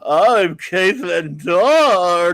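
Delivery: hugely drawn-out, loud hammed-up introduction; loud, very slow mock audiobook voice; George Takei. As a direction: stretch each word grandly, mocking a male narrator playing a woman. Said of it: "George Takei saying".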